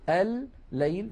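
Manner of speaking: الليل drawn out slowly as al-layl; doubled lam. Shown as a demonstration. Teacher demonstrating the merged lam, الليل with long ll. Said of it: "فيكون النطق بلام مشددة".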